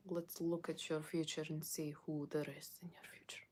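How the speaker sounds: put-on whispered Russian accent, no voice; mysterious Russian psychic. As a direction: hush it like a Russian fortune teller. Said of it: "have myself as a Russian psychic".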